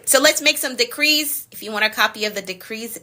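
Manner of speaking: ordinary delivery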